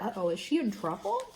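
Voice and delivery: ordinary delivery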